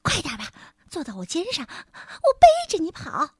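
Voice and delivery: high squeaky sprite grumbling; a tiny creature scolding, high; little sprite voice. A narrator's reading. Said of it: "小家伙嘟囔了一句". (尖细的小精灵嘟囔)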